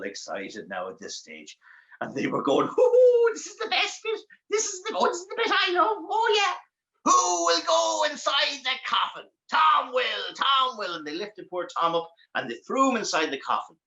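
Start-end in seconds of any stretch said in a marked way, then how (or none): 2.7-10.9 very high, cackling goblin voice; delighted screeching imp; shrieking gleeful demon. Acted. Demons at the best bit.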